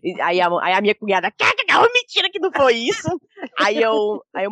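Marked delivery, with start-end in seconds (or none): 1.3-3.1 high, loud imitation of a cackling relative; mock cackling protest. Imitating her sister-in-law at the restaurant, high loud cackle. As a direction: crow it in a raised voice, half laughing.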